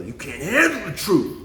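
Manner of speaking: drawn-out, emphatic film-line imitation; movie quote impression. Quoting a movie about truth, slow hammed-up outburst. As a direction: act out the famous line, slow, with HANDLE swooping up. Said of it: "you ever watch that movie".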